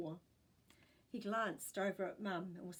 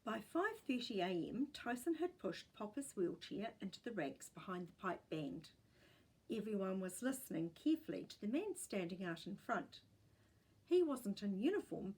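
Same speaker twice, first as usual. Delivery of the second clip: usual speaking voice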